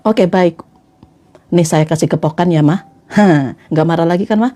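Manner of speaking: low-voiced husband making peace; placating husband. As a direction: placate her, pitch well down. Acts husband placating wife. (suami membujuk dengan suara rendah)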